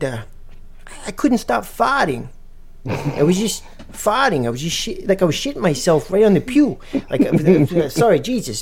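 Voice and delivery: Giovanni. Retold or imitated. G-Money confesses his church farting to Ben, high put-on voice. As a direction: confess in a high old-man voice. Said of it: "He's like".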